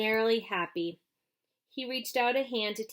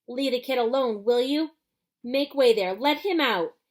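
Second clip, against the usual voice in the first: louder, bossy commands; firm shout; 3 semitones higher, 7 dB louder than usual. A narrator's reading. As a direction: clear a path through the crowd. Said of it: "the fat shopkeeper shouted".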